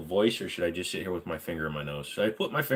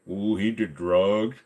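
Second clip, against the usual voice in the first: silly voice; slower than usual. Pretend. Put-on voice comment.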